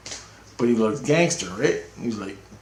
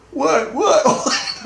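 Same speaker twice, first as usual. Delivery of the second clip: very high, squeaky, laughing "what?"; mock disbelief; much slower than usual. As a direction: act baffled, voice leaping up through laughter. Acted disbelief at friend's vague answer.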